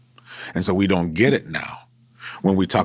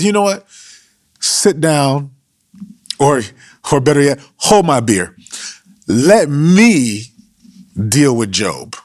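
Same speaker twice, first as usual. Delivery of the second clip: cocky street talk, louder and higher; 5 semitones higher, 5 dB louder than usual. Retold or imitated. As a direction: hold my beer, let me handle this.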